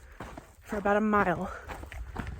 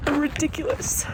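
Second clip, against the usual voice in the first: crying laugh, high rising; 7 semitones higher than usual. Real, not acted. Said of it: "I can't stop crying".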